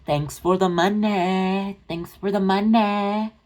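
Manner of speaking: high, slow, sing-song mocking voice; a creator mocked for thanking fans for money, high and slow; mock thanks. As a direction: drag it out high and fake-sweet. Mocking Amber thanking for superchats.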